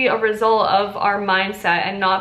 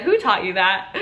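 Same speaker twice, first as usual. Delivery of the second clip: laughing through "Who taught you that?"; laughing question. Retold or imitated. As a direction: ask it while chuckling. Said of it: "she laughed because she said".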